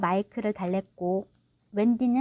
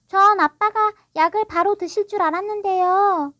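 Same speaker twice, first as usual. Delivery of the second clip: high, louder daughter's voice; puzzled girl; 9 semitones higher, 6 dB louder than usual. A narrator's reading. Wendy asking why her father delays his medicine, puzzled. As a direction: question him in innocent puzzlement. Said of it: "이해되지 않는다는 표정으로 말했다".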